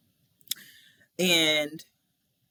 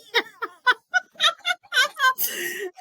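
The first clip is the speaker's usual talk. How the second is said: shrieking high laughter; giggling high cackle; 5 dB louder than usual. Real, not acted. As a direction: burst out laughing. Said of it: "big laugh".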